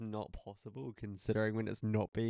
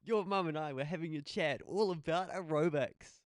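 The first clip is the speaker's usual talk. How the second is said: higher-pitched mock radio presenter; 6 semitones higher than usual. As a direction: put on your radio host voice. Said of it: "your more radio voice".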